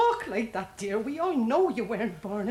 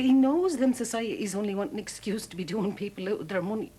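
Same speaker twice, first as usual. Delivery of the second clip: ordinary talk, nothing marked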